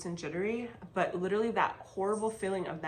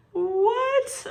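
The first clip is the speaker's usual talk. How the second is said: much higher, louder squeal of disbelief; shocked what; 10 dB louder than usual. Retold or imitated. To the doctor who just said she's healthy, shocked squeal. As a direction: shriek it in total shock.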